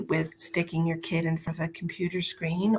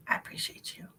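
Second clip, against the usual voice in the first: soft breathy whisper of thanks; hushed whispered thank-you; 11 dB quieter, faster than usual. Shown as a demonstration. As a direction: whisper it like a little secret.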